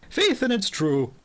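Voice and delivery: a light Irish brogue; earnest Irish gardener swearing it; Irish accent. Acted. The gardener insists to the inspector, light Irish accent. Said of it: "O'Toole, the gardener".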